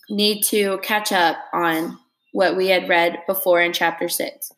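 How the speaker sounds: ordinary delivery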